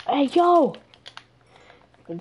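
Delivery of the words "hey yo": loud, high shout; calling from far away. Real, not acted. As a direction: yell to get attention. Calling villager again.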